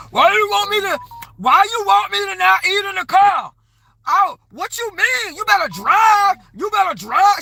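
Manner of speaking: high, loud, belligerent shouting; yelling at the Uber driver in a high, screechy, aggressive voice; loud belligerent woman. Retold or imitated. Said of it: "just loud and belligerent".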